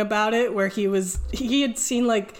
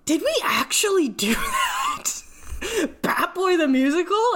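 incredulous friend; 10 semitones higher than usual. Retold or imitated. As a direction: imitate a friend's stunned double-take, pitched way up. Friend stunned at the memory.

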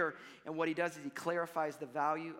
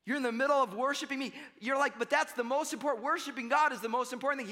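raised mock protest; 7 semitones higher, 4 dB louder than usual. Voicing a listener objecting.

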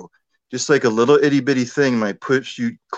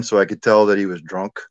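ordinary talk, nothing marked

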